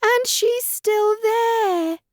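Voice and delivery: put-on baby bear pointing at Goldilocks, slow excited squeak. A narrator's reading. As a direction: squeak it out, thrilled and tiny. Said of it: "Baby Bear squeaked excitedly".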